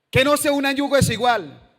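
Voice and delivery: loud high proclamation of the verse; preacher shouting the Bible's command; booming scripture. Acted. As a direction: thunder the commandment.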